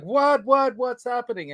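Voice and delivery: mock panic, what's going on; alarmed. Retelling his alarmed reply.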